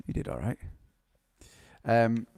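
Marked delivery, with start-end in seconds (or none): 0.1-0.6 soft, lower repeat; quiet murmur. Real, not acted. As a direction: say it under your breath. Repeated more softly.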